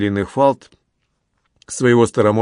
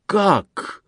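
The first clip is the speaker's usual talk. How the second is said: much higher, shocked exclamation; almost shouting; 10 semitones higher than usual. A narrator's reading. Uncle shocked by his guest, almost crying out. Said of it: "почти закричал Дон Антонио".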